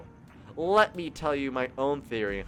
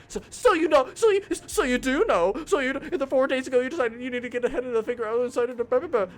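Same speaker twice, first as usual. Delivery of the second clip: agitated accusing; 10 semitones higher, 5 dB louder than usual. Acted.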